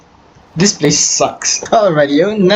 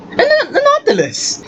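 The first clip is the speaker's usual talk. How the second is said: excited squeal. Real, not acted. Spots a rare mob.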